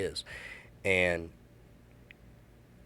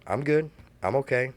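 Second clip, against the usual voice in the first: higher, louder put-on cheer; forced cheer; 6 semitones higher, 4 dB louder than usual. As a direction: brush it off brightly to hide the tears. Hiding tears from his daughter.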